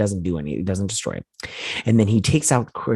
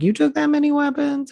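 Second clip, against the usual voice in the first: high amazed. Retold or imitated. Amazed quote about weapons.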